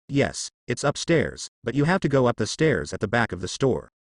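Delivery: ordinary delivery, nothing marked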